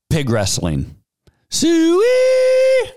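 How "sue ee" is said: high, loud drawn-out pig call; hog call. Pretend. Host calls pigs at the end of his intro, high and loud. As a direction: call the hogs in from the field, way up high.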